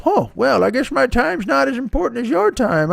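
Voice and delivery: martyred grandpa sighing over his wasted time; grumpy grandfather. Retold or imitated. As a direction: play a passive-aggressive old grandfather. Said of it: "there's a grandfather who just goes".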